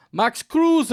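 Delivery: high, loud hammed-up German football commentator; German commentary voice. Mocking football players, a hammed-up German commentator call. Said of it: "that was my German commentary voice".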